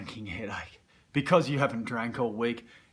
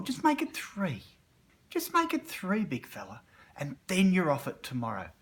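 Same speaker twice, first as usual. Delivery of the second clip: tempting dark side; 6 semitones higher than usual. Retold or imitated.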